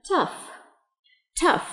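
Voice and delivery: "Tough" short, with a clear breath of f; final f. Shown as a demonstration. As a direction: model "Tough" ending on f, no g. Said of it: "âm cuối của nó sẽ là âm f".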